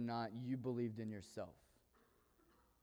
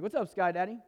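nickname greeting; 6 semitones higher, 6 dB louder than usual. Retold or imitated. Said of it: "I actually called him Sky Daddy".

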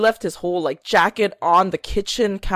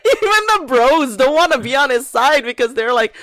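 laughing as she says it, a little higher; 6 semitones higher, 4 dB louder than usual. Real, not acted. Telling her friend about the bros, laughing. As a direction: let the laugh spill through the words.

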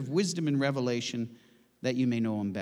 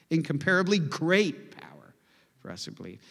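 Incomparably stressed once more; 3 dB louder than usual. Shown as a demonstration. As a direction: hit Incomparably, let the rest go. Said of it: "I'll emphasize a different word each time".

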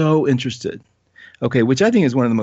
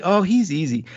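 arrogant quip; 6 semitones higher than usual. Retold or imitated. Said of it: "I was like".

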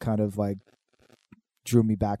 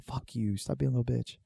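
hushed; 9 dB quieter than usual. Retold or imitated. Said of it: "I'm like".

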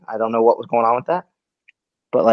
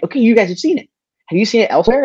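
urgent questioning; 9 semitones higher, 3 dB louder, faster than usual. Retold or imitated. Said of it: "I'm like".

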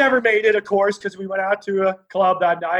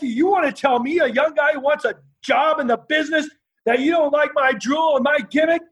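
high-pitched furious tirade at the promoter; angry rant; 7 semitones higher than usual. Retold or imitated. Young wrestler tearing into the sweating promoter, furious. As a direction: rip into him, pitch up and hammering each insult. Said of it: "I was dropping every word".

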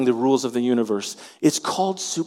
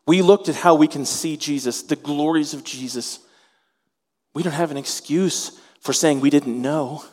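ordinary talk, nothing marked

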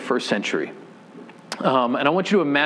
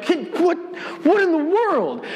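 much higher, astonished cry of disbelief; stunned disbelief; 12 semitones higher than usual. Retold or imitated. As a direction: gasp it out as if a dead friend just walked in. Imagining seeing dead friend alive.

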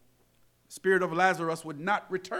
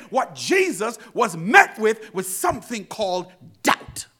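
raised, driving pulpit delivery; full-force preaching; 6 semitones higher, 8 dB louder than usual. Real, not acted. Preacher tells congregation what Jesus really faced, fervent preaching. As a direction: build insistently on each repeated phrase.

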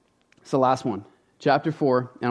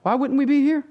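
much higher, light cheery voice of the volunteers; 12 semitones higher than usual. Retold or imitated. Pastor imitates cheerful volunteers at the door, high and light. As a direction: play the cheerful volunteers brushing off the pastor's concern. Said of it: "it was like".

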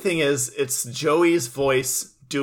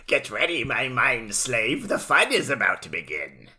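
hammed-up evil mind controller; sinister Merrick. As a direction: purr the threat like a gloating mastermind. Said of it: "you hear Merrick go".

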